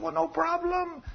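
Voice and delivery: high, soft, drawn-out reassurance; cunning cousin. Acted. Cousin answers the lovesick man, slyly breezy. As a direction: play a sly schemer making light of it.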